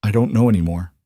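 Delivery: choked, barely holding it together; throat tight with grief. A narrator's reading. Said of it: "Her throat tightened".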